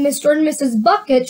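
usual speaking voice

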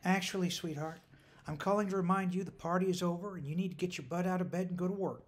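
firm but not unkind; not unkindly. A narrator's reading. Mother telling her daughter to get up, not unkindly. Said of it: "not unkindly".

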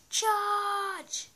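high, playful battle cry; rally the troops, half joking; mock heroic charge.

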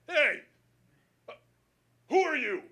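high, loud, indignant dog owner; pissed-off challenge. Retold or imitated. Dog owner confronting a stranger on the lawn, pissed. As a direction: call out sharply at a stranger. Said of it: "He was pissed".

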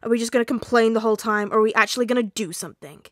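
loud, impatient challenge; yelling. A narrator's reading. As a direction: shout at the group to get moving. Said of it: "Bakugo yelled".

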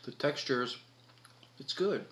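talking between bites; chewing while talking. Real, not acted. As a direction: mouth partly full of fries. Seen 0: Bites fry near +1.2, hand at mouth chewing through +7.4.

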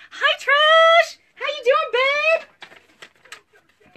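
very high, loud sing-song hello; excited greeting. Real, not acted. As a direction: squeal hello to a friend. Greeting a viewer.

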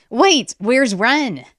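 high, loud, slow worried call; alarmed yelp. A narrator's reading. Boy noticing his sister is missing, alarmed yelp. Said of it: "Zin yelped".

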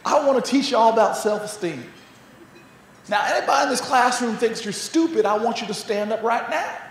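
imitated Southern US teacher, addressing a class; butchered Southern accent. Teacher challenging pupils on the first day, mock Southern accent. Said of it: "forgive me for butchering that accent".

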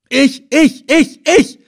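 high, loud yells of several volunteers; clamouring to be picked; eager shouting. A narrator's reading. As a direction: shout each word as a separate eager cry. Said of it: "schrien mehrere".

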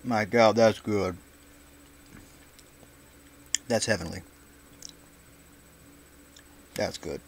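chewing while talking; talking through a bite of food; mouth full. Real, not acted. Seen 1: hand to mouth before, then jaw working, cheeks full while talking.

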